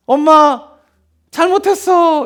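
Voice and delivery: high-pitched pleading child imitation; apologising child. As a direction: mimic a child begging mom's forgiveness. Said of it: "처음에는 그러다가도 어떻게 합니까?". (엄마에게 잘못을 비는 아이 흉내)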